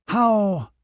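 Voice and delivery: high ringing call. A narrator's reading. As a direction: call it out in unison. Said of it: "the men called out".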